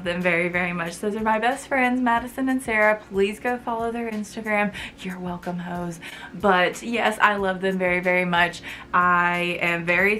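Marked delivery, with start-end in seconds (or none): none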